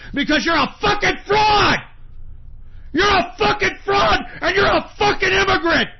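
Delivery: screaming in real rage, high and loud; real furious screaming rant; furious shout. Real, not acted. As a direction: yell the insults with all your fury. Furious rant at Elon Musk.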